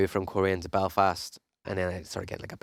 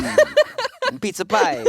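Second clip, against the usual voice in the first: much higher, louder cartoon New York Italian; mock New York Italian; 7 dB louder, much slower than usual. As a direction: squeal it like a pizza-shop cliché. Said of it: "That's as close to America".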